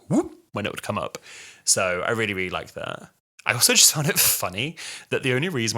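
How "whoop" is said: quick "whoop" noise; imitated sound effect. Reviewer mimicking the blackboard appearing, imitated whoop. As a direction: mimic a cartoon whoosh-up sound. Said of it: "amazing sound effects that were like".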